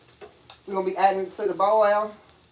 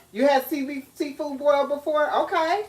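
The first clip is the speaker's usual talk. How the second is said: usual speaking voice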